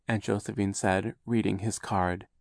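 ordinary delivery, nothing marked

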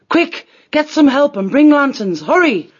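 urgent call for help; urgent order. Acted. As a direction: send someone running for help. Urgent order on finding a body.

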